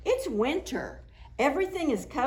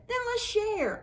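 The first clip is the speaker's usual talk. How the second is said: put-on little girl voice; 12 semitones higher than usual. A narrator's reading. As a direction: sound like a small kind girl.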